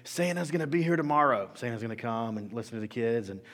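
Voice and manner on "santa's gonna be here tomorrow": higher, louder manager announcement; manager announcing. Retold or imitated. Manager rallying the store staff, mock announcement. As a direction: call out the news like a store manager. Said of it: "the manager comes in".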